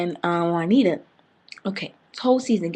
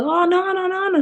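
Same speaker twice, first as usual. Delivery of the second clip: much higher, loud squealed no's; mock refusal; 12 semitones higher, 5 dB louder than usual. Bree pushing away Keith's father's kiss, high squealing refusal. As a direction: squeal the no's like fending him off.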